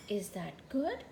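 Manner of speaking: ordinary delivery, nothing marked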